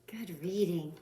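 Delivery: whisper. Real, not acted. Praising child in aside.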